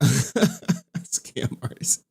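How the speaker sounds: slow whispered jab through a laugh. Real, not acted. Streamer teasing a donor again, whispered through a laugh.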